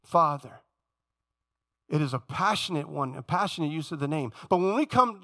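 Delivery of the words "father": Abba cried out higher and louder, then Father dropping; pleading cry to the father in an uproar. Retold or imitated. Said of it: "you're crying out".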